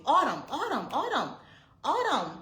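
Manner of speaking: loud, high calls of the name, again and again; calling out a name. Retold or imitated. Mother calling her missing daughter through the house, loud and high. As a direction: call for the lost child at full voice, rising high. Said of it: "I'm calling her name constantly".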